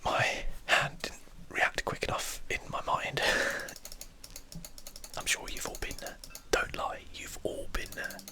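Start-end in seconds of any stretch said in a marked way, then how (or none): 3.3-5.1 laugh. Real, not acted. Laughs at own mistake.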